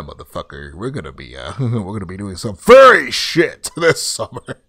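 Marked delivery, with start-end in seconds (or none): none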